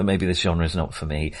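ordinary talk, nothing marked

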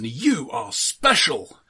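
normal talking voice

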